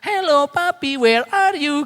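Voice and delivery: suara anak tinggi memanggil papinya; suara anak kecil. Retold or imitated. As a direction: tirukan anak kecil dengan suara jauh lebih tinggi. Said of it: "Gitu dia gitu kan". (anak dua tahun memanggil papinya saat meeting, suara anak tinggi)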